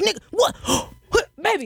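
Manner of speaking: jolted awake, high and startled, with a gasp; shocked boyfriend. Retold or imitated. Boyfriend caught between both girlfriends, startled high yelp. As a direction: caught red-handed and yelping in disbelief.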